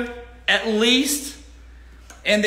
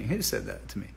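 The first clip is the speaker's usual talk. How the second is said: softer, muttering to himself; a softer self-asking "Who said that to me?"; quiet mock wondering; 12 semitones lower, 11 dB quieter than usual. Shown as a demonstration. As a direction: ask it again under his breath.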